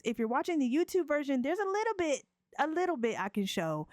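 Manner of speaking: ordinary talk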